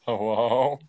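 static-filled call; crackly cordless phone. Real, not acted. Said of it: "I don't know what you're saying".

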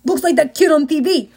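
lightly voiced gruff cop; a hint of a tough policeman. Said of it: "A gruff cop voice said".

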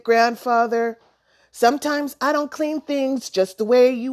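hint loudly that someone else should do it; pointed hinting. A narrator's reading. Boy hinting loudly for grandfather's help.

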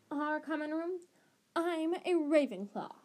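a lightly voiced prim girl, put out; stiff Ravenclaw girl. A narrator's reading. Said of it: "said the girl stiffly".

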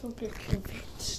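slow soft whisper. Real, not acted. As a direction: whisper it slowly. Echoing friend, whispered.

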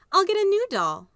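put-on high, loud brave child; high little girl voice. Maybelle reassures Mama she'll get a new doll.

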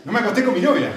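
high, loud protest with a laugh; mock protest. Preacher mimicking young people's excuse about girlfriend, mock protest. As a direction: exaggerate the lame excuse, laughing. Mocks the youths' excuse, repeated.

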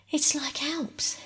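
put-on high, breathy child's gasp out of breath from climbing; breathless. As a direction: puff it out after the steep climb. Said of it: "said Bobby breathlessly".

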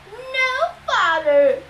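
high then loud mimicry; mock her whiny reply; mocking imitation. A narrator's reading. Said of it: "Ares mimicked".

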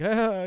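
sudden high loud wail of pain; crying wail. Retold or imitated. Man who lost his leg, mock crying wail. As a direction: let out a hammed-up crying "ah". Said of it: "he's crying".

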